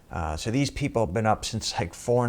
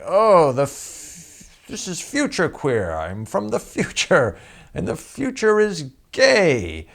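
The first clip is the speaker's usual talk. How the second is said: high, slow, loud camp proclamation; Future Queer character; 9 semitones higher, 6 dB louder, slower than usual. Retold or imitated. Comic performing his character for the producer, high, slow and loud. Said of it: "I start being Future Queer".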